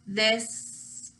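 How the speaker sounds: ordinary talk, nothing marked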